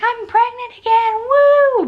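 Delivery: high, loud mock cheer; excited squeal. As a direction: squeal it like big news. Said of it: "be like".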